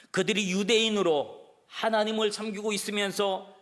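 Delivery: loud, high, driving sermon voice; fiery preaching. Acted. Preacher rebuking the congregation, loud and high. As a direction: preach it out hard to the pews.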